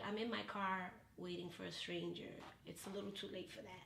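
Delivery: low, very soft quote of a put-out man; subdued retold refusal. Date waiting in his car refuses, subdued. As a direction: drop down low and quiet, unimpressed.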